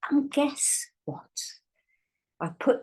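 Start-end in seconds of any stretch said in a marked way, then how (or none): 0.1-1.3 whisper. Acted. Teacher teasing a reveal.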